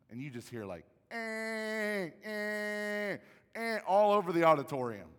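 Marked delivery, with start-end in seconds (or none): 1.1-3.8 put-on loud, high baby wail; imitated crying baby. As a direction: mimic babies wailing across an auditorium. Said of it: "let there be no crying babies".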